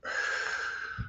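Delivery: soft breathy release of a held breath; long exhale. Shown as a demonstration. Coach leading deep breaths, long exhale. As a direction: let the air all out slowly. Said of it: "hold it a little bit".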